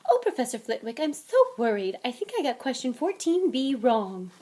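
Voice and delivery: high-pitched put-on fretting; mock high voice. Ron mocking Hermione to Flitwick, high voice. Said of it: "He put on a high fo-, voice".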